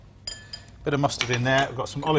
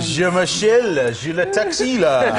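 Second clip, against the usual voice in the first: slow hammed-up French voice, louder; French with Yorkshire accent; 4 dB louder, slower than usual. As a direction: drawl it like a mock French chef. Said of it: "French with Yorkshire accent".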